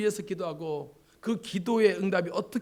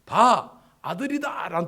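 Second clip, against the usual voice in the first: bursts out high and loud: look, a son; crying out in triumph; joyful cry; 4 semitones higher, 10 dB louder, slower than usual. Acted. Leah crying out her joy at a son. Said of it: "르벤이란 말은 뭐냐면".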